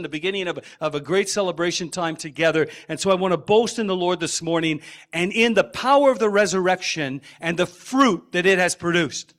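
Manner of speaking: ordinary talk, nothing marked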